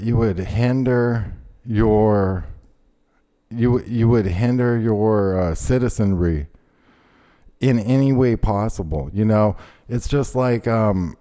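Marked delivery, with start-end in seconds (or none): none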